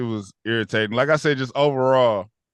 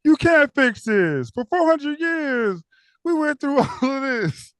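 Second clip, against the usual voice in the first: imitating the character; 12 semitones higher than usual. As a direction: deliver it like a heavy verdict. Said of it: "He said".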